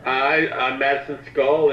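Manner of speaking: ordinary talk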